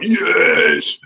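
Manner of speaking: long gleeful yes; triumphant cheer. Retold or imitated. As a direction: celebrate a prank that worked. Pranksters celebrate waking someone.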